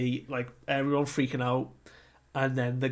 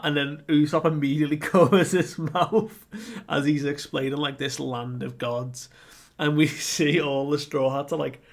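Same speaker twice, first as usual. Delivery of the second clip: ordinary talk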